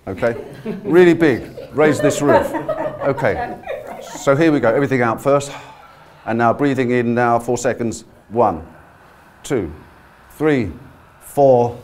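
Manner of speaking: ordinary delivery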